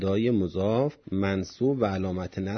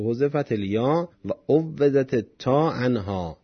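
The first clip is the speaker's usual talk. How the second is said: slow text reading; 4 semitones higher, 5 dB louder than usual. Shown as a demonstration. Reading the Arabic commentary.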